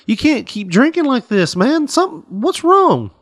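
pleading friend, voice going up; worried friend. Acted. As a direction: pitch climbing as he pleads. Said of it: "he's like".

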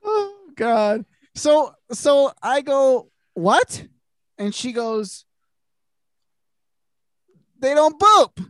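high, breaking into laughter while talking; can barely get the words out for laughing; giggling squeaks through the punchline. Real, not acted. Cracking up retelling story.

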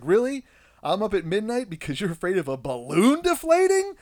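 mock outraged tired dad; exasperated. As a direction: vent the disbelief at the kid. Exasperated at son.

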